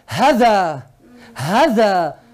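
"hada" with a quick, short first a; short vowel. Shown as a demonstration. Teacher demonstrates ha with short fatha, short vowel. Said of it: "con fatha corta será".

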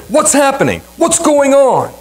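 pitched well up, anxious asking; urgent blind beggar's question. Retold or imitated. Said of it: "he said".

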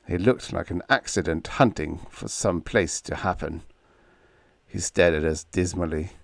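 usual speaking voice

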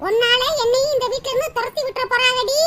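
ordinary talk, nothing marked

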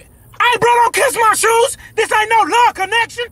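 high, loud, slowed disgust building to a yelp; outraged shout. Acted. Robber yells when victim kisses his shoes, high outraged shout. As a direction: push him off, appalled.

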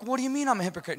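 high, offended comeback at normal volume; mock-indignant question; retold indignant protest. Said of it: "we get in this big fight".

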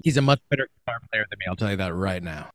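choppy, breaking-up audio; audio cutting out. Real, not acted. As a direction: voice dropping out on a bad line. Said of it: "your audio is still doing it".

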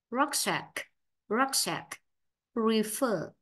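ordinary talk, nothing marked

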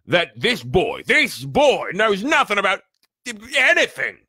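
loud, high, mounting outrage; angry growl. A narrator's reading. Giant accuses the family over the boy's ignorance, growling angrily. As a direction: build to an incredulous shout. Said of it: "he growled at the Dursleys".

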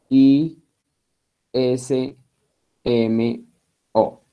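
each letter of agnosticismo named alone, with a pause after each; spelled letter by letter. Shown as a demonstration. Teacher spelling a word for the class, letter by letter. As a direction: spell it out one letter at a time. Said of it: "se los voy a deletrear".

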